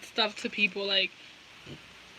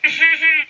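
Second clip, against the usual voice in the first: loud, higher staccato "ah-ha-ha"; weird laugh demo; 4 semitones higher, 9 dB louder than usual. Shown as a demonstration. Showing viewers their weird laugh, loud and staccato. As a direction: show off a silly put-on laugh. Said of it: "I have some weird-ass fucking laughs".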